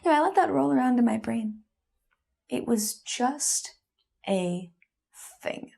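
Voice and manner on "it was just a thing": word by word with long pauses, fading out; hurt, halting repetition of his phrase; slow, pondering echo. Acted. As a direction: turn each word over slowly, trailing to near nothing. Turning his phrase over in thought.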